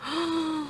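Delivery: soft, high gasp of wonder; high little intake of breath; awed gasp. Real, not acted. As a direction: gasp softly at something lovely. Awed reaction to the unboxed pictures.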